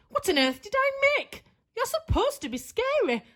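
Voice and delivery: high, fast screechy witch; screeching witch. Acted. Witch scolding the friendly pumpkin, high screech. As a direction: shriek at the mistake. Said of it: "screeched the witch".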